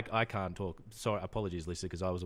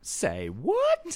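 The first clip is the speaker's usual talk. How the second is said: surprised; 9 semitones higher, 8 dB louder than usual. Real, not acted. Surprised reaction.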